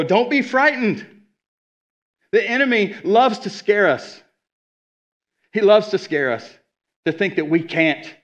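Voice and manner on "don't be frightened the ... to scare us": preaching at full force. Real, not acted. Preaching climax, rising intensity.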